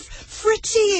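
high delighted cry of the dog's name; a girl thrilled to see her dog; excited exclaim. Acted. Jennifer greeting the returned dog, high excited cry. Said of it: "exclaimed Jennifer".

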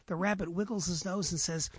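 ordinary talk

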